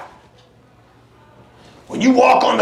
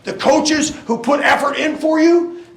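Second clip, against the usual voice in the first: halftime pep speech; 5 semitones higher than usual. Acted. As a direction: build to a shout. Pep talk climax.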